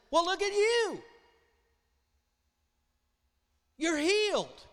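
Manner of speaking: high, loud joyful call; excited holler. Acted. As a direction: holler across the road in delight. Leper hollering to healed friend.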